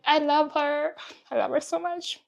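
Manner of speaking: overflowing affection for her friend; gushing love. Real, not acted.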